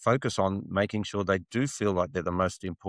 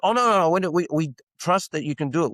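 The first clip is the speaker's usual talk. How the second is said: high, quick mock-client voice, much higher; client reassuring salesman; 7 semitones higher, 3 dB louder, faster than usual. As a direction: play the eager client brushing it off, fast.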